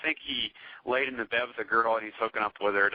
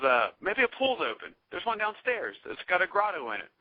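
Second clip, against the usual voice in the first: higher, lightly voiced girl; light imitation of her, pitched up; mock girl voice; 6 semitones higher than usual. Meg suggesting the pool downstairs, a higher mock girl voice.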